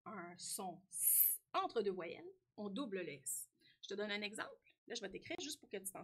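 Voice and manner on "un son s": "S" as a drawn-out sss after a slow lead-in; held S sound. Shown as a demonstration. Teacher states the spelling rule, "S" drawn out as sss. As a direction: hold "S" as a hissing consonant, not a letter name. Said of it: "quand on retrouve".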